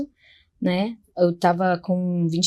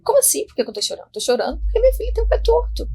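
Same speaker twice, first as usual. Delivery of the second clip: much higher, fast, desperate plea; retold desperate crying; 12 semitones higher, faster than usual. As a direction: replay the sobbing answer in a pitched-up rush. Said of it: "Aí eu chorei desesperadamente mesmo". (choro desesperado relembrado, agudo)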